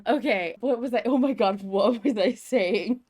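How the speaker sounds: words breaking up with giggles; laughing while talking. Real, not acted. Co-host laughing as she forgets what she was saying. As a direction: laugh through the line as you lose your place. Laughing mid-podcast, lost her thread.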